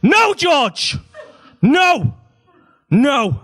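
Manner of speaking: put-on high, loud, stern no to a child; firm shouted no. Father shouting firm no at son George. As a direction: put your foot down with a sharp cry. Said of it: "I tried to be strong".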